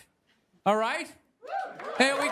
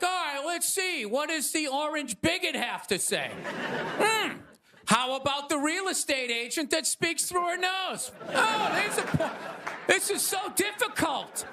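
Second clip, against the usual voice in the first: higher-pitched mock dithering; hammed-up struggle to choose between candidates; agonizing undecided voter; 6 semitones higher than usual. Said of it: "like, still not decided?".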